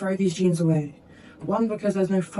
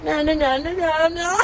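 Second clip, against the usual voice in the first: firm refusal; 7 semitones higher than usual. Retold or imitated. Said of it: "she was like".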